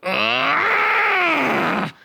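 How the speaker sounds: long, high, loud shouted ugh; strangled rage noise; enraged groan. Acted. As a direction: choke out a furious ugh. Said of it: "was all I could manage".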